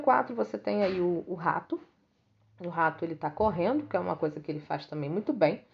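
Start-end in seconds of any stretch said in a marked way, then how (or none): none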